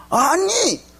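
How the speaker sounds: put-on high, shouting child talking back; talking back loudly. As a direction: snap back at mom with a high yell. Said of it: "막 이렇게 소리를 지르는 거예요". (엄마에게 대드는 아이가 높게 소리치며)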